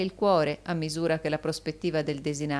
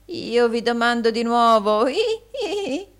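high, loud protesting voice; hammed-up humility with tittering; wheedling old usurer; 9 semitones higher, 7 dB louder than usual. Gride protests fawningly.

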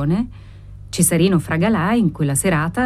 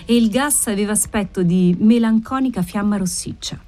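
ordinary delivery, nothing marked

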